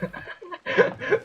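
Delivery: high, wheezy laugh; helpless high giggle. Real, not acted. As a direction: burst into high, breathless laughter. Laughs at own joke.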